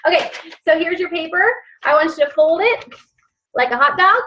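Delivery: put-on sing-song teacher talking to little kids; kindergarten teacher voice. Shown as a demonstration. Teacher giving folding steps to the class in kindergarten teacher voice. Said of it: "Can you tell I was a kindergarten teacher?".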